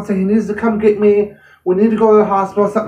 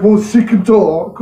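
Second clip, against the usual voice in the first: rude dismissive paramedic; slower than usual. Retold or imitated. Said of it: "They're like / horribly rude".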